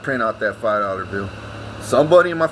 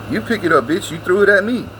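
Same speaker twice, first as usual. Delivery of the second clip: retold angry retort; 6 semitones higher than usual. Grandson retells yelling at grandma over the bill, angry. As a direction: throw it back at her.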